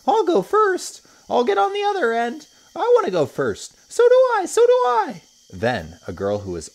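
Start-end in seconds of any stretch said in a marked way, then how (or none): 0.1-5.2 put-on much higher, louder children squabbling to go first; excited shouting. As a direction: kids shouting over each other. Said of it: "shouting with excitement".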